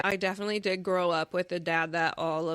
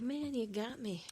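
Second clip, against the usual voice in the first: quiet, higher-pitched admission; retold soft concession; 5 semitones higher, 9 dB quieter than usual. Daughter recalling her reply to dad's advice, soft concession. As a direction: give in softly, voice lifted. Retells own moved reply to dad.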